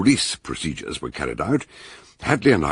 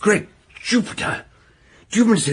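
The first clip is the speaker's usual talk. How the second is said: high, sudden exclamation of amazement; astonished. Acted. Detective amazed the test was filmed, surprised exclamation. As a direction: jump up in pitch with surprise.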